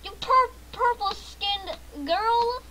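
high, loud outburst at an opponent; loud, high-pitched gamer rage; frustrated yell. Real, not acted. Yelling at an enemy player.

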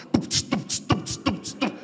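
beatboxed thump and hi-hat; imitated music beat. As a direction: mouth a steady dance beat. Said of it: "Soltou a música". (batida de música feita com a boca)

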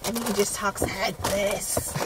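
slow, put-on imitation of a messed-up voice; mocking his voice. Wife teasing husband about his messed-up voice, mocking imitation. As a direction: draw it out to make fun of him. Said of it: "making fun of my voice".

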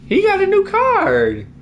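high-pitched excited squeal; thrilled falsetto over the new card. Real, not acted. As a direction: squeal it out in delight. Reacting to new card pull.

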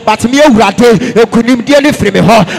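loud, driving Pentecostal sermon; fervent preacher rousing the congregation; full-force preaching. Real, not acted. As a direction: preach it hard in short, punchy phrases.

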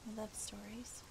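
soft unvoiced whisper. Real, not acted. To Gio, whispering her love of stories. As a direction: breathe it like a secret. Friend gushing about the storytelling game.

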